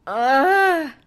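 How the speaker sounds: loud, high pained "ah"; pained cry. A narrator's reading. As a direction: cry out as if hurt. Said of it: "scrunch her face up in a pained grimace".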